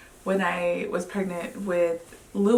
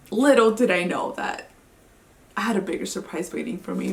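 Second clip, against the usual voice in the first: ordinary talk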